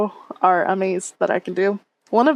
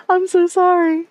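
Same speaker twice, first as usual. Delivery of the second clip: gushing apology; 10 semitones higher, 4 dB louder than usual. Pretend. Mock apology to streamer.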